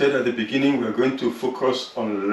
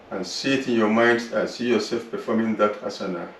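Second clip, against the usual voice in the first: calm, unhurried relaxation voice; guided meditation; 6 dB quieter than usual. Acted. Yoga teacher guiding students through relaxation, calm meditation voice. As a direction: guide the class gently through the body.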